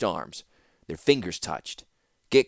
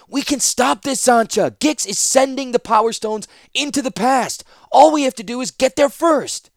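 high, loud urgent plea; loud pleading; 14 semitones higher, 8 dB louder than usual. Acted. Ratapi urging Zancha to stop Gix, high and loud. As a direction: beg her to act now.

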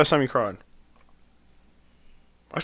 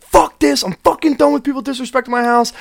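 put-on drunk angry outburst; 12 semitones higher than usual. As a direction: yell it like you've had enough of the crowd. Retells drunk crying at party.

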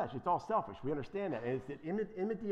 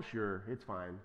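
mock casual approval; 8 semitones lower, 6 dB quieter, slower than usual. Mocking churches that condone anything.

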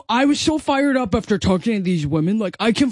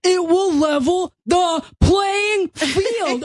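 shouting; 8 semitones higher, much slower than usual. Acted. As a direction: bellow each word slowly. Z shouts her answer.

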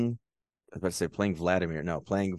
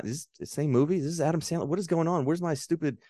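higher, mock-baffled questioning; bewildered reaction; 6 semitones higher than usual. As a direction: act out a confused viewer's rising disbelief. Retold bewildered reaction to a film.

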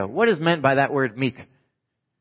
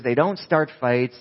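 usual speaking voice

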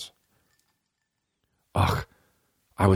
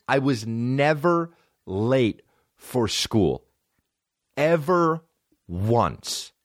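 slow, spaced words, "never" raised high; emphatic insistence; 6 semitones higher, much slower than usual. Real, not acted. Setting the record straight, slow insistent "never" and high "Ever". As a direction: hammer "never" and "Ever", pausing between words.

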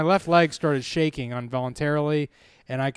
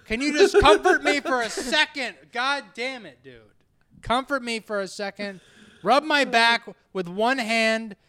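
high, hammed-up pleading; exasperated plea; 6 semitones higher than usual. Man pleads with her to comfort him, exasperated mock begging. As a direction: beg for comfort, pitched up and fed up. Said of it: "I'm like".

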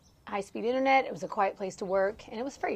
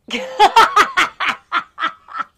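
loud high laugh bursting out; real burst of laughter; 8 semitones higher, 12 dB louder than usual. Real, not acted. Laughing while telling the nutmeg story. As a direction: crack up helplessly.

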